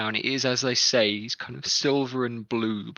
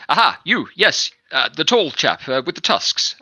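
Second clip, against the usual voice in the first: loud, high calling captain; single him out loudly; guard captain calling; 5 semitones higher, 5 dB louder than usual. Acted. Captain calls out tall chap.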